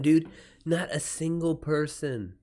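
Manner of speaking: ordinary talk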